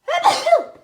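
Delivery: loud sudden cough; coughing hard into the mic; real cough. Real, not acted. Crafter on livestream coughs loudly, real cough.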